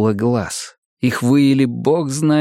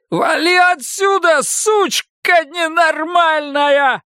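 desperate high-pitched drawn-out yell; full-voiced scream to drive someone away; slower than usual. A narrator's reading. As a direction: scream it high, stretching out the words. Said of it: "заорал Бадди".

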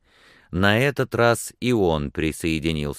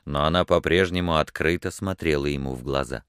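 plain reading voice

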